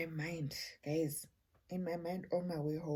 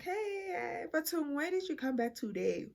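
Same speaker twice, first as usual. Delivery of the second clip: surprised greeting; 10 semitones higher than usual. Retold or imitated. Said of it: "I'm like".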